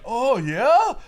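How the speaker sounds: high, loud mock-innocent Odin. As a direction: play innocent as a sly god. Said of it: "Odin's just pretending to be like".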